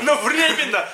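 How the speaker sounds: high, laughing repeat; laughing echo. Retold or imitated. Mocking tagline, echoed laughing.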